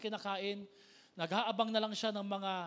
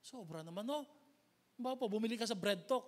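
ordinary talk, nothing marked

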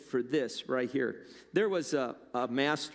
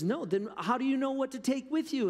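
much higher, startled servant voice; surprised Stick Man; 10 semitones higher than usual. Acted. Stick Man gasps at the master's leaving, much higher voice. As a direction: play the simple servant, high and astonished.

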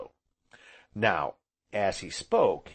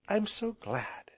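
high, slow, pleased murmur; glowing with pleasure; 10 semitones higher, 4 dB quieter, slower than usual. A narrator's reading. Heroine to guest who likes her home, softly delighted. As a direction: say it slowly, lifted with pleasure. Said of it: "her eyes glowing with pleasure".

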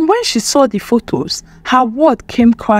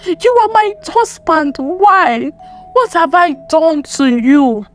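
high-pitched, anguished accusation; distraught cry; 6 semitones higher than usual. A narrator's reading. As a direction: plead for an answer from those who betrayed you. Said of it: "she cried, her voice shaking".

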